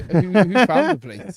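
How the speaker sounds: loud, slow, mock-offended protest; indignant outburst. Real, not acted. Teasing co-host, loud mock indignation. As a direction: demand it back, half joking. Mock row over studio.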